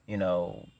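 ordinary delivery

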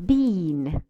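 Bean with a long ee. Shown as a demonstration. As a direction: hold the ee in Bean. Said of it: "Let's see the difference".

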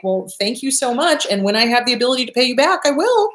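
a chirpy fake-nice kiss-off; sweetly dismissive. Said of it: "it's like".

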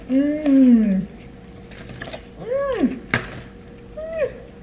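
rising delighted mmm; yummy mmm. Real, not acted. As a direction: savour the taste, rising. Tasting granola.

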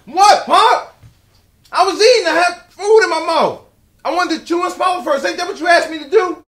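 loud high shouted replies; shouting back. Acted. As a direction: yell back across the house. Son shouts back from distance.

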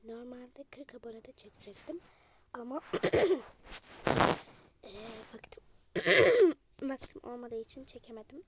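stuffy, sickly voice with coughs. Real, not acted. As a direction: talk through a cold. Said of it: "bu arada sesim çok kötü".

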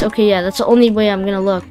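ordinary talk

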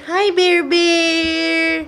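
high, slow cooing at a puppy. Real, not acted. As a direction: coo at the dog. Baby talk to the dog.

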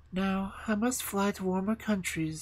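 a hint of a haughty queen; snow queen voice. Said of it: "said the Snow Queen".

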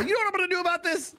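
high, fast, manic threat; hammed-up Jim Carrey quoting Liar Liar; Jim Carrey rant. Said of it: "And he's all".

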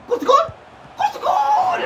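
high excited goal cry; goal shout. Real, not acted. Gamer celebrating a goal, high excited shout. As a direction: scream it as the ball goes in.

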